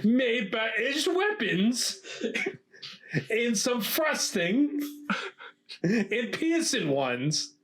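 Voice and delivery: slow, high, hammed-up posh British read; bad British accent. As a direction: read it like a stuffy British lecturer. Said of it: "Such a bad accent".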